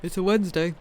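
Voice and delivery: through a yawn. Real, not acted. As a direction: talk mid-yawn. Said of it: "I'm yawning".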